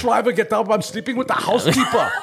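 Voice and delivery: blunt Arnold voice confessing; Schwarzenegger impression. Joking about Arnold's affair, Austrian accent with laughter. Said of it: "Arnold can't do it either".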